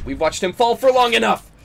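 high, loud battle yell; urgent shout. Real, not acted. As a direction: holler for cover under fire. Urgent shout while under fire.

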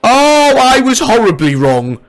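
high shout of dismay. Real, not acted. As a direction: yelp it out, loud and high. Loses round, pegs coming.

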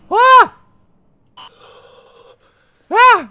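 very high, loud shriek of fright; piercing yelp of alarm; startled scream. Real, not acted. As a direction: scream at a jump scare. Jump scare in game.

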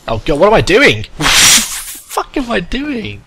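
high loud laughing panic; panicked yelling. Real, not acted. Player panicking in a boss fight, laughing and yelling. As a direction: flail and laugh in alarm.

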